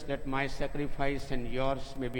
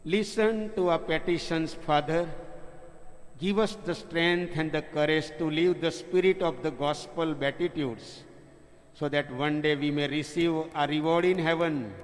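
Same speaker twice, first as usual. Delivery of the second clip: raised, solemn prayer voice. Real, not acted. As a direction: lift it up to the Father. Priest's closing prayer, raised voice.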